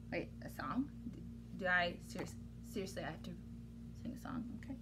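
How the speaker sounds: soft, low reluctant whisper; dismayed whisper. Real, not acted. Reader dreading the song, hushed and reluctant. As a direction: dread it quietly.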